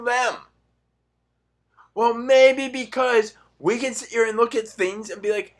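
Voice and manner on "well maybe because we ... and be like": normal talking voice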